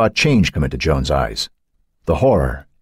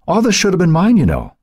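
high, louder covetous claim; 14 semitones higher, 3 dB louder than usual. A narrator's reading. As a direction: claim the riches wistfully. Covetous outburst, high loud.

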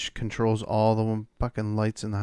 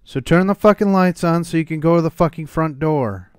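loud, higher frustrated shout; exasperated yelling; 4 semitones higher, 6 dB louder than usual. Real, not acted. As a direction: blow up at the game. Frustrated outburst at game.

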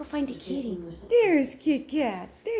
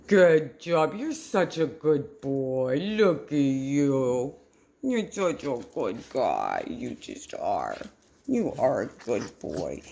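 warm praise; 9 semitones lower than usual. Real, not acted. Praising the dog warmly.

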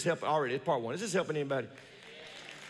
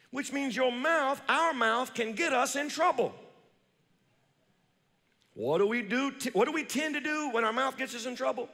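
ordinary talk, nothing marked

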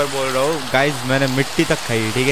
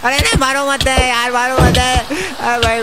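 panicked begging; 7 semitones higher than usual. Pretend. Streamer pleads with players attacking him, playfully panicked. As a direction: beg them off in playful panic, voice shooting up.

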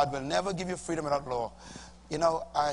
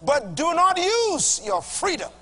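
scripture reading; 11 semitones higher, 4 dB louder than usual. Shown as a demonstration. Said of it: "Read the next verse".

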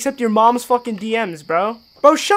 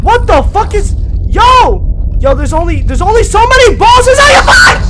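loud, high, panicked yelling; a real startled outburst; panicked shout; 10 semitones higher, 8 dB louder than usual. Real, not acted. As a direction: yell it as the boss jumps you. Startled by a boss.

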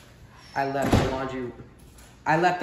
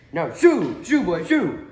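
louder fly shoo; a higher, louder fly shooing the boy; 5 semitones higher, 10 dB louder, slower than usual. Acted. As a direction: shoo him off loudly.

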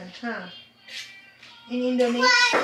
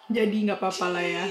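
usual speaking voice

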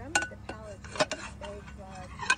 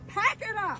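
much higher, loud barked order; angry shout; 11 semitones higher, 7 dB louder, slower than usual. Real, not acted. Board member ordering a lobster cook out of the parking, angry shout. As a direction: yell the command to get them moving. Resident orders cooks to leave.

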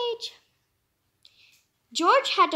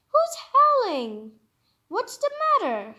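George asking; 7 semitones higher than usual. Acted. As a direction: draw it out high. George asks the dog.

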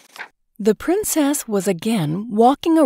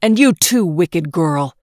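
loud, accusing outburst; angry cry; 4 dB louder than usual. Acted. Emperor to his daughter, angry outburst. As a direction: turn the rage on her. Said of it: "he cried".

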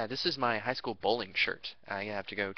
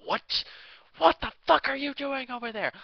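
much higher, loud screaming gamer rant; raging gamer; 14 semitones higher, 7 dB louder than usual. Retold or imitated. Overheard boyfriend yelling at his game, shrieking. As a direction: shriek like a gamer losing it mid-match. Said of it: "all you hear in the background is".

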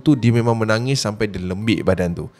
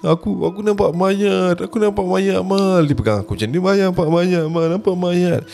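frightened sobbing friend; 8 semitones higher than usual. Retold or imitated. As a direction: stammer it out like you've just seen a corpse. Terrified friend crying in stairwell. (suara takut menangis)

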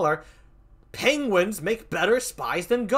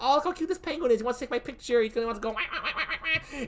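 cooing alien; 6 semitones higher, 4 dB quieter, much faster than usual. Retold or imitated. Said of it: "they're gonna be like".

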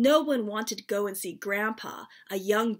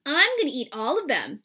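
teasing; 3 semitones higher, 6 dB louder than usual. Acted. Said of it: "Dad teased".

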